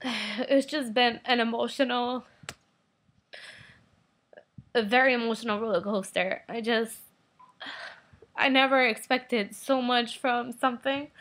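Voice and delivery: tearful, sighing, voice breaking; real tears, trying to stop; crying. Real, not acted. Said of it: "I'm crying right now".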